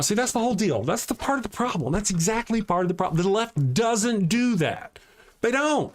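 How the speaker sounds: worked-up rant, pitched high; railing hard against the left; excited ranting. Real, not acted. Streamer to chat, worked up and ranting high. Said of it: "Papa's all excited and he's yelling".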